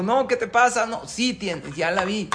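loud, high indignant denial; quarrel. Acted. Husband and wife argue over the mirror, loud and indignant. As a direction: snap back at the accusation.

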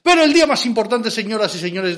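high, loud opening, easing slightly; ringmaster announcement. Pretend. Host announcing the most important day, ringmaster announcement. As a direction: hail the crowd like a showman.

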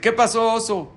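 high, loud call to the bear; startled question. Acted. Jaim hailing a collapsed bear in the woods, startled question.

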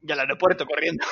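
amused jab tipping into a big laugh; laughing tease. Real, not acted. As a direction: tease through rising laughter. Friend teasing, bursting into laughter.

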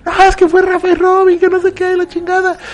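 very high whiny tattletale voice; tattling high voice. Retold or imitated. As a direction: squeak out the blame like a snitching kid. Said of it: "voicing someone telling on them". (voz muy aguda de acusón)

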